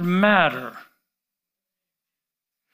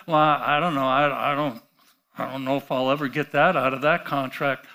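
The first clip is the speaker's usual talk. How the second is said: low, soft, fast mumbling doubt; mock doubter; 7 semitones lower, 4 dB quieter, faster than usual. Preacher mocks a doubtful believer, low hesitant mumble. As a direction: mimic a timid doubter mumbling. Mocking a doubting contract holder.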